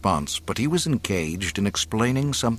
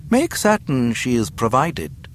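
higher, relishing final order; smiling menace; 7 semitones higher than usual. A narrator's reading. Governor ordering guards about the captive's view, smiling cruelty. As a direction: savour the cruelty with a smile. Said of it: "he smiled".

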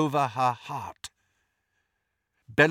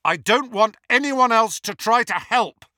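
loud, raised, carrying voice; loud declaration; 8 semitones higher, 5 dB louder than usual. A narrator's reading. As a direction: call it out to the whole hall. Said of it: "Harry said loudly".

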